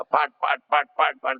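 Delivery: punchy spoken thwacks; imitated stick blows. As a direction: mimic a stick striking repeatedly. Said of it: "दस मारा उन्होंने डंडा".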